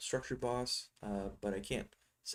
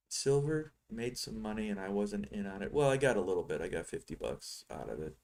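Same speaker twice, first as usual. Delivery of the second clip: ordinary talk, nothing marked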